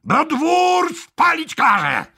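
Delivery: high-pitched shout; captain's threatening cry, pitched far up; shouted demand. A narrator's reading. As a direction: demand surrender across a battlefield.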